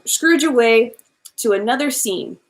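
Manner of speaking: usual speaking voice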